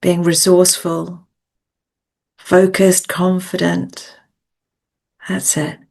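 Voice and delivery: measured coaching meditation voice with long pauses; guided visualisation. Acted. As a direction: guide them gently inward, phrase by phrase, leaving space to breathe.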